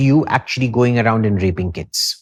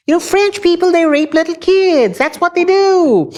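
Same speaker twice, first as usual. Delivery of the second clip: 1 mocking falsetto. Mocking French stereotype, falsetto.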